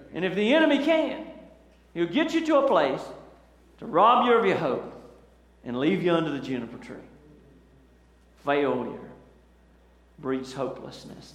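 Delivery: ordinary talk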